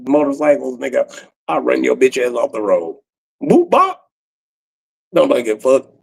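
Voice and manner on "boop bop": high boop bop noise; playful high-pitched bump sounds; mock sound effect. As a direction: pop it out high like a cartoon bump. Imitated crash noise.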